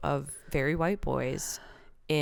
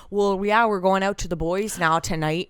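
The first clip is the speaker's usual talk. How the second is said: put-on bright, louder East Coast bro accent; 3 semitones higher, 4 dB louder than usual. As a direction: mimic a loud East Coast partygoer. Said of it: "sounds like she's, um, full East Coast".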